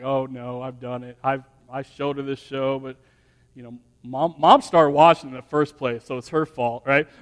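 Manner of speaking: low, soft muttered confession; acted inner panic, kept under the breath; guilty aside. Dad realising he showed her this show, low guilty aside.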